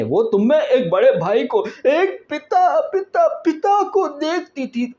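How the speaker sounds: building to high, stretched-out proclamation; mock melodrama. Father explaining his daughter saw a father figure, hammed-up melodrama. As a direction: swell theatrically, pitch climbing on each repeat.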